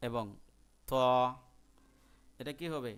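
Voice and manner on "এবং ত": ordinary talk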